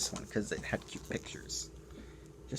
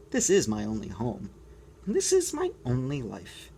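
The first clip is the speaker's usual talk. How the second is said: lightly voiced penguin, from shrill to thoughtful; 5 semitones higher, 9 dB louder than usual. Penguin voice resumes.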